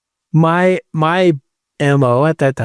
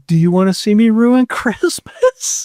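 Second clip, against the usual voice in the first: inner voice; 6 semitones higher than usual. Retold or imitated. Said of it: "just a voice in my head is like".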